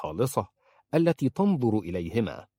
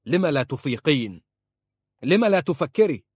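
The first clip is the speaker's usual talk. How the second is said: raised, higher-pitched angry demand; exasperated outburst, building louder; angry shouting; 6 semitones higher, 5 dB louder than usual. A narrator's reading. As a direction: shout it in her face, exasperated. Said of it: "صاح في وجهها".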